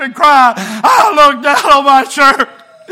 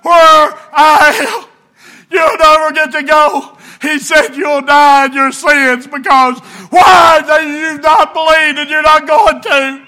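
loud pleading sermon, slow at the end; preaching at full force; 5 semitones higher, 4 dB louder than usual. Acted. Preacher pleading with sinners, full-force preaching. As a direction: plead with them to believe.

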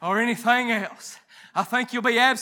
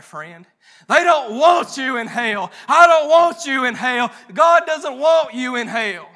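louder, shouted revival preaching; preaching at full force; 3 semitones higher, 7 dB louder than usual. Acted. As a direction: drive it home to the pews with force. Preaching to the congregation.

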